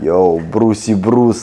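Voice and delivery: slow, drawn-out Brucey Bruce with heavy R's; rolled R mockery. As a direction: tease him by stretching his name. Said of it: "those R's are working".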